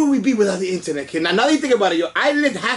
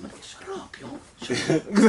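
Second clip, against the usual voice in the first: whispered, much softer shut up; mock hushed order; 5 dB quieter than usual. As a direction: hiss it under your breath to hush him. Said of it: "he's like".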